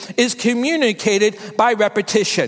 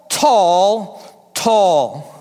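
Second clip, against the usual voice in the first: each "tall" drops lower and lower; emphatic repetition; 5 semitones lower than usual. Shown as a demonstration. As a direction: lean harder on every "tall", sinking in pitch. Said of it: "my repetitive emphasis on the word tall".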